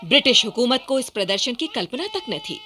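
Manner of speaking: ordinary talk, nothing marked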